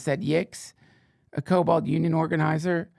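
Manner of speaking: ordinary delivery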